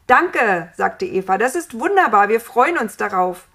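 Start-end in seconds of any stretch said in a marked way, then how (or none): 0.1-0.7 loud, higher, joyful thanks; delighted thanks. A narrator's reading. Eva thanking God for children, delighted. 1.4-3.5 loud, lively, joyful exclamation; delighted. A narrator's reading. Eva looking forward to children, delighted.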